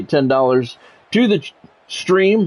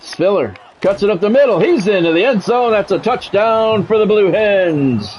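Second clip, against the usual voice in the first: higher excited announcer voice, slowing on the score; touchdown call; 6 semitones higher than usual. Acted. Streamer calling a touchdown in his game, excited.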